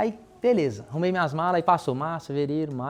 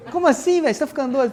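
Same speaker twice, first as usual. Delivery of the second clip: high-pitched, baffled mock questioning; incredulous; 10 semitones higher, 3 dB louder, faster than usual. Friend questioning his packed suitcase, high-pitched incredulity. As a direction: act out a friend's disbelief, pitch jumping up high.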